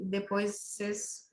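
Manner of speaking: usual speaking voice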